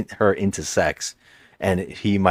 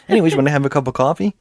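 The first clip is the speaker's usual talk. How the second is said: mock breezy; 5 semitones higher, much faster than usual. Breezy offer after head scene.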